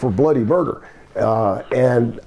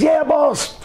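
much higher, eager little yes; meek reply; 13 semitones higher than usual. Retold or imitated. Coco answering his boss, eager and high. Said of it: "He says".

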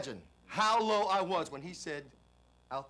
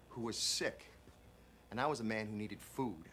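ordinary talk

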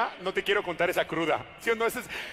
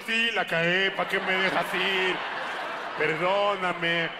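slow guilty plea; 2 semitones lower, slower than usual. Retold or imitated. Guilty drunk pleading in his head.

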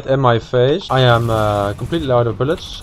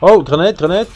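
loud urgent warning; grenade warning yell; 5 semitones higher, 4 dB louder than usual. Real, not acted. Grenade incoming in firefight.

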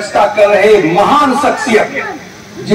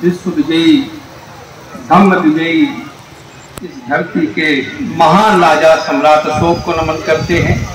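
formal speech; 4 semitones lower than usual. Acted. Solemn formal invocation.

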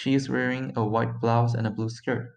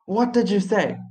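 mildly brutal, higher-pitched demand; 5 semitones higher than usual. A narrator's reading. French driver confronting someone over crossing the road, brutal. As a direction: confront him roughly, voice raised in pitch. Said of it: "said brutally by the French driver".

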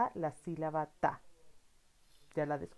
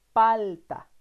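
louder, clear model word; model word for repeating; 2 semitones higher, 8 dB louder than usual. Shown as a demonstration. Teacher to child, loud model word to repeat. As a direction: say it out for the child to copy. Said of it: "repite conmigo".

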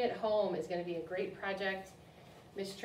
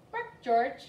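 higher, louder mother giving an order; mother's command; 6 semitones higher, 8 dB louder than usual. Acted. Mother dog telling George to bark, firm command. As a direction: read it as a mother telling her puppy what to do.

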